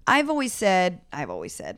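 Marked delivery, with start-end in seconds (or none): 1.1-1.8 dropped far lower and softer; mock solemn. Guest restarts a personal motto for laughs, mock solemn. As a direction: repeat it in a put-on deep, hushed voice.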